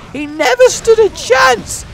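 very high, loud, drawn-out cry; over-the-top high shout; excited exclamation. Real, not acted. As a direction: crow it out slowly at high pitch. Exclaims over battered enemy.

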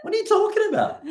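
very high, disbelieving question; can't believe what they just heard, way up high; incredulous teachers. Retold or imitated. Teachers baffled by capsicum.

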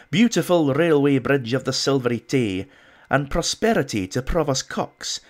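loud, hammed-up Scottish accent reading a bad poem. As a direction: declaim it in a mock Scottish brogue. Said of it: "in my best Scottish accent".

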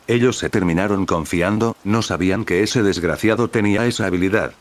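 ordinary delivery, nothing marked

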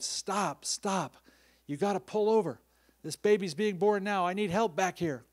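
high, loud call to the driver to stop; retold panicked shout. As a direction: relive the panic and yell to pull over.

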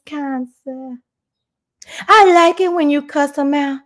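cooing mock-devoted Southern accent. Mock fan reassuring her kind sir, coy Southern voice. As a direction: simper to your beloved in Southern tones. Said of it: "get out my real Southern voice".

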